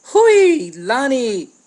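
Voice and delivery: very high, very loud calling out; big yell. A narrator's reading. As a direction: call across the water to her sister. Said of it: "Nani let out a big yell".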